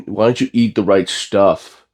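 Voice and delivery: usual speaking voice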